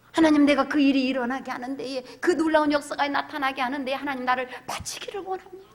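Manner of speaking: loud fervent prayer. Acted. Pastor leading the congregation in dedication, loud fervent prayer. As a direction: pray it out at full voice.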